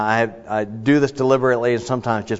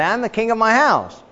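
"king" said in a raised, boastful voice; proud king; 11 semitones higher than usual. Pretend. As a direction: claim the crown like a proud king. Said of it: "So I say".

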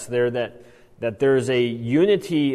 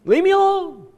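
put-on much higher, louder childish wail; three-year-old tantrum; 6 dB louder than usual. Preacher mimics the demon as a three-year-old, shrill tantrum wail. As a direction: whine it like a toddler throwing a fit. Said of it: "something any three-year-old knows".

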